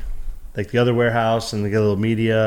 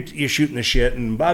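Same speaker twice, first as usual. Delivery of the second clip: ordinary talk, nothing marked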